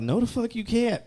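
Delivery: high-pitched, laughing disbelief; amused, high mock protest; incredulous retort. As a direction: fire back in comic outrage. Acts out retort to the teachers.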